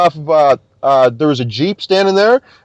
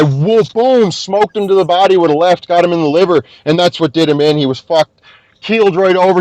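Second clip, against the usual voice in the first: ordinary talk, nothing marked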